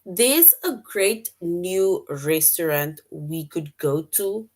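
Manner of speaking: slow, careful reading for learners; slow reading aloud. Shown as a demonstration. Teacher reading the sentence to students, slow and careful. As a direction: read each word out slowly for the class. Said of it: "It says".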